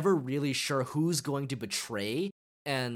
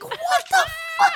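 stunned shout; 9 dB louder than usual. Retold or imitated. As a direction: shriek it in total disbelief. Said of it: "you're like".

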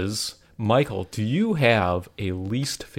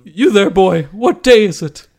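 put-on higher, louder old Scrooge shout; Scrooge calling out; 8 semitones higher, 11 dB louder, slower than usual. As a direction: bellow down to the street boy.

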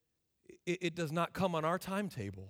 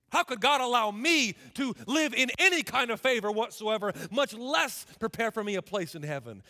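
louder, driving sermon questions; forceful preaching; 4 semitones higher, 6 dB louder than usual. Real, not acted. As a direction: press each question hard on the congregation.

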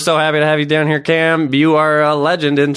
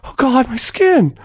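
higher, pained groaning patient; lightly hammed-up burn victim; 8 semitones higher, slower than usual. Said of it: "I'll do the character".

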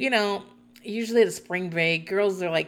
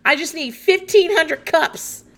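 retold phone yell; 8 semitones higher, 6 dB louder than usual. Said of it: "she's yelling into her phone".

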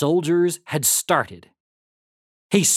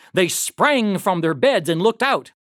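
ordinary delivery

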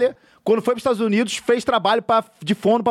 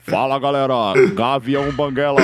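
put-on Cuiabá accent; 5 semitones lower than usual. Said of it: "É assim, ó".

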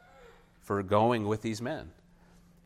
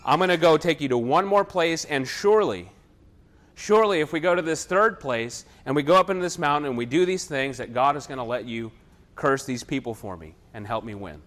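louder, higher angry insistence; a furious king forcing one more try; 4 semitones higher, 7 dB louder than usual. Retold or imitated. Angry Balak drags Balaam to the third place, loud. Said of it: "And he gets angry with him".

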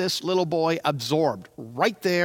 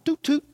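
high mock horn toot; imitated car horn; 8 semitones higher than usual. Imitating dad's car horn for the boy.

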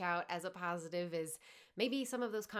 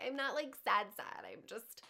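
lightly voiced, higher insistent protest; 3 semitones higher than usual. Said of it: "And I'm like".

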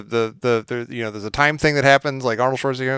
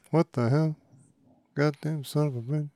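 softly trailing movie-quote mumble; muttering to oneself; 2 semitones higher, 6 dB quieter than usual. Retold or imitated. Quoting a favorite movie line to himself, muttered. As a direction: mutter the quote, sinking softer at the end. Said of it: "I say that to myself constantly".

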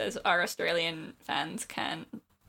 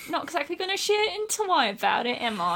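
hammy London Cockney, pitched up; Cockney geezer; 9 semitones higher than usual. Acted. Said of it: "I'm a Cockney geezer".

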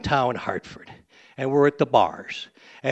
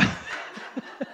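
breathy laugh; 14 dB quieter than usual. Real, not acted. Laughs at own joke.

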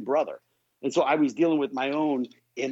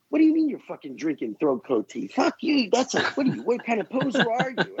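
mock angry; 7 semitones higher than usual. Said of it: "And he goes".

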